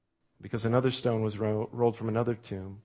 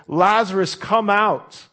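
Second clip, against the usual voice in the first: a ringing shout, much higher and louder; loud call; 9 semitones higher, 9 dB louder, much slower than usual. Acted. Jesus calling Lazarus out of the tomb, a loud ringing shout. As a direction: call him out of the tomb at full voice. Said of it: "he calls out in a loud voice".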